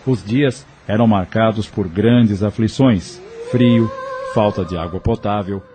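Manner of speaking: normal talking voice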